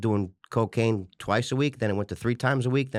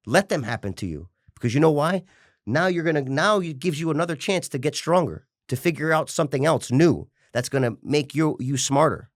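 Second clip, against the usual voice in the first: usual speaking voice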